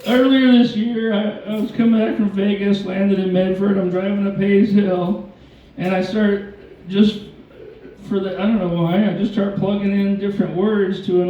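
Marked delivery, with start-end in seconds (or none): none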